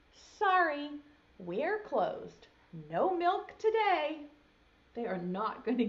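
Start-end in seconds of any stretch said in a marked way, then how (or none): 0.1-4.3 high sign-reading voice; note from the cows. Acted. Reading the cows' sign to a child, high and bright.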